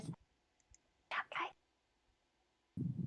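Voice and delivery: barely audible, voiceless whisper; very soft secret whisper. Acted. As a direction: whisper tiny praise under your breath. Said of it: "she whispers".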